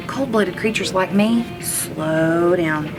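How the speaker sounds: plain reading voice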